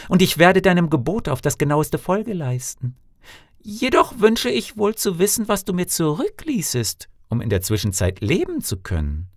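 high wife's voice, politely asking; reply obediently in a high voice; obedient Canetella. A narrator's reading. Canetella asks her husband what she will live on, high meek voice.

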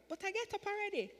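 very high, bewildered objection; high-pitched, incredulous reply; puzzled protest. Retold or imitated. Retold puzzled answer to voice.

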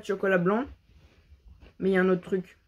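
snack at lips; talking while chewing. Real, not acted. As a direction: mouth partly full. Seen 2: Snack held at lips, mouth appears to chew throughout.

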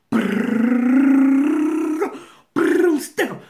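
high, loud rolled brrr like gunfire; machine gun noise. Retold or imitated. Mimicking the neighbor rattling orders like a machine gun. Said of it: "she sound like a machine gun".

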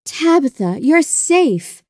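mother crying out, pitched high; relieved cry. A narrator's reading. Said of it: "cried her mother".

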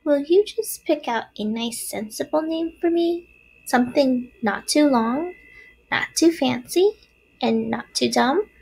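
high, small voice listing wishes; little spider. A narrator's reading. Third spider asks Wilbur for a name, small voice. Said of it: "asked the third spider".

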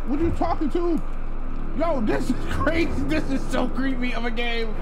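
high, loud spooked reaction; freaked out, talking it through. Real, not acted.

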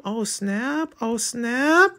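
high, soft mock gasp; playful high-pitched oh snap. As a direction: squeal it up high. Exclaiming at comic twist.

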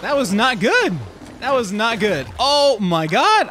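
loud frustration. Real, not acted. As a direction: complain loudly at the screen. Frustrated at game.

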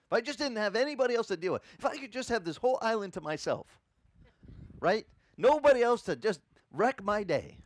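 mock selfish wish. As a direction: whine like someone who wants everyone gone. Mocking a selfish wish for peace.

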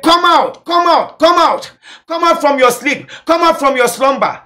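high-pitched, urgent calling out; loud cry. Acted. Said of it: "A cry is a loud voice".

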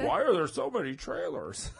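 querulous moviegoer impersonation; Jimmy Stewart. Shown as a demonstration. Comic demonstrating how to do Caitlyn, high Jimmy Stewart impression. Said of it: "Jimmy Stewart whispering in a movie theater".

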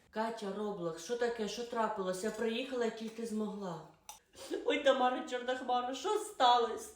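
ordinary talk, nothing marked

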